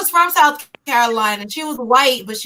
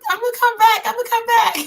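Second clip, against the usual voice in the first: much higher, sing-song excuse; polite excuse; 11 semitones higher, faster than usual. Retold or imitated. Guest fleeing the bloody turkey, high sing-song excuse. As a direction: slip away in a high polite voice.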